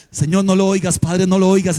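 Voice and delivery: loud hammed-up plea to God, begging; a worshipper begging God not to hear, mock loud plea; mock panicked prayer. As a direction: cry out in mock fear, pleading. Mocking a frightened believer's prayer.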